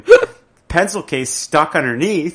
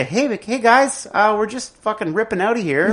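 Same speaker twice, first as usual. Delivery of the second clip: higher, breezy, chummy pilot announcement; casual laid-back pilot; 7 semitones higher than usual. Retold or imitated. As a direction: talk to passengers like buddies, pitched up. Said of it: "why don't you just say".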